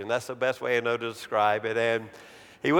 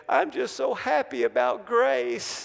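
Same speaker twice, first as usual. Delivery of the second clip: much higher-pitched imitation of a wailing boy; mock tearful wail; 10 semitones higher than usual. Crying boy answering his dad, mock wail. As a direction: act out a crying child, pitched way up. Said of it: "the kid just started screaming louder and louder".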